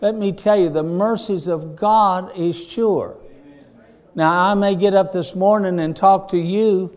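full-force pulpit delivery; preaching. Acted. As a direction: preach it out to the congregation.

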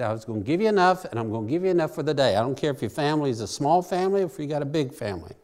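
fast, lightly voiced God speaking; God's promise. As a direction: rattle it off quickly as God. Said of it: "He said".

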